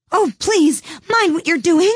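high, loud panicked cry; terrified cry. A narrator's reading. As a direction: shriek it out in terror. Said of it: "cried Alice".

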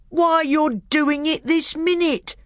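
high, drawn-out exasperated bellow. A narrator's reading. Trumpkin catching the bear sucking his paw, bellowing. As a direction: bellow it indignantly, word by word. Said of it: "bellowed Trumpkin".

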